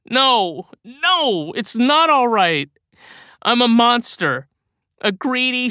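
high, loud, tearful self-hatred; tearful rant. A narrator's reading. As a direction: wail it out between sobs. Bill sobbing self-loathing rant.

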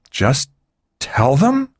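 sharp high disbelieving rise on them; can't believe what she heard; incredulous. A narrator's reading. Rosselli doubting Gunderson's plan, incredulous. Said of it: "Rosselli said incredulously".

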